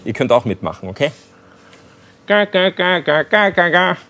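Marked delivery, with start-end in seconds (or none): 2.3-4.0 singing around a cork; cork in teeth. Shown as a demonstration. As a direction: hum the tune with a cork between teeth. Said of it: "muss man manchmal den Korken zwischen die Zähne nehmen".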